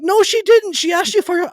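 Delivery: very high, loud protest; frantic protest. Retold or imitated. A son protests to his companion in the car, high and loud. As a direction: shout it up high in disbelief. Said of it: "And I'm like".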